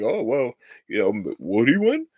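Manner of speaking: a hint of a wishy-washy fan shrugging it off; mock doubtful fan.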